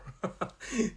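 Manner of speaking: short bright laugh. Real, not acted. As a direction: burst out laughing. Laughs at own story.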